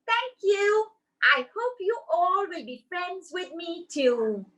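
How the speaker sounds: high, sweet little puppet voice; a shy puppet thanking the children; Goldie the puppet. Acted.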